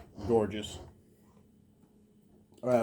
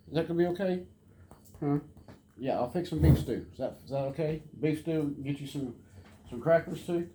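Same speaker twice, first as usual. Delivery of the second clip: pet talk; 5 semitones higher than usual. Real, not acted. Offering food to the dog.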